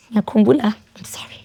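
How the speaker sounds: choked up, voice fading away; real tearful breakdown. Real, not acted. Guest overcome by the memory on camera, choking up. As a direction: apologise through tears, barely getting it out.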